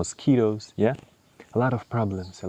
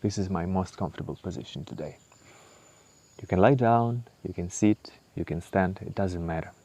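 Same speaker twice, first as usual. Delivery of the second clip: ordinary delivery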